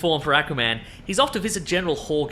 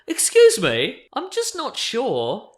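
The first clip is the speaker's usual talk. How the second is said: higher, slow hesitant confusion; confused fan letter; 7 semitones higher, 4 dB louder, much slower than usual. Retold or imitated. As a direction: ask it like a bewildered reader. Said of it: "and being like".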